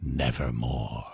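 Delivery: raven's reply. Acted. Raven answers the grieving narrator, low and ominous. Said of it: "Then the bird said".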